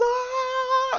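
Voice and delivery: very high held scream; mock agonized scream. Villain's hand gets crushed by Hulk, high mock scream. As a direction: shriek as if your hand is crushed.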